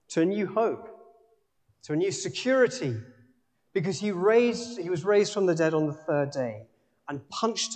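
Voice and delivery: impassioned full-force sermon voice; preaching. Acted. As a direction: preach it with rising fervour. Preaching passionately.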